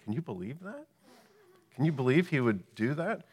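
mock-shocked tempter voice; insinuating serpent. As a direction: plant doubt like the serpent whispering. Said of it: "Oh, do you see the emphasis?".

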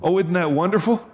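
ordinary talk, nothing marked

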